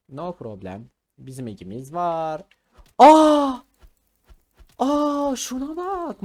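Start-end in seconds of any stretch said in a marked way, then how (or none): 3.0-6.2 high, loud gasp of surprise; surprised shout. Real, not acted. Gamer spots an enemy in his base, shouts in surprise. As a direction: jump up in surprise.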